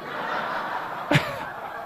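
high airy laugh; preacher laughing at his own story; breathy laugh. Real, not acted. Laugh after punchline.

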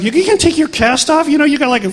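imitating a startled nurse; mock panic, voice jumping up; nurse freaking out. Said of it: "The nurse freaks out".

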